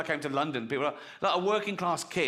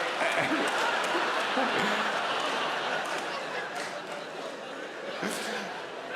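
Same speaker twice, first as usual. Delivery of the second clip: a long, wheezing fit of silent laughter; long real laugh; 7 semitones higher than usual. Real, not acted. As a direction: keep laughing, almost soundless. Long laugh after punchline.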